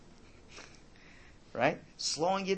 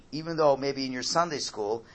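ordinary talk, nothing marked

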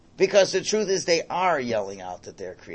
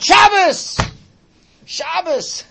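high, loud mock scream of Shabbos; vine screaming out; 11 dB louder, much slower than usual. Grapevine warns a tempted plucker on Shabbos, high mock scream. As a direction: shriek Shabbos like a warning grapevine. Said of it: "the vine will scream out".

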